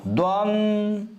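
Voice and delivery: द्वंद drawn out very slowly, a piece at a time; द्वंद stretched out as a dictated fragment; slow dictation. Shown as a demonstration. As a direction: spell out द्वंद slowly while writing it. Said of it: "अब इसको सही शब्द कैसे लिखेंगे?".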